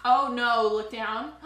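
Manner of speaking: normal talking voice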